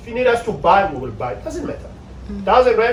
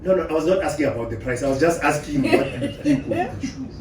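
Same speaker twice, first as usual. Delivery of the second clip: quick, softer defensive protest; mock wife defending; 5 semitones lower, 7 dB quieter than usual. Wife explaining she asked about the shoe, quick and defensive. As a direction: rush to explain you meant no harm.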